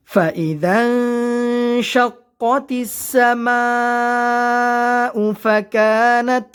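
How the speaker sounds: slow, melodic Quran recitation. Shown as a demonstration. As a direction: chant each word slowly with long vowels. Recites verse slowly.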